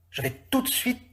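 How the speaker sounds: high, breathless eager voice; eager coachman. Acted. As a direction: hurry to please the master. Same coachman voice.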